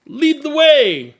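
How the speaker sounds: rally the group behind her; big hearty cheer; rousing cheer. Acted. One cheers Zero on.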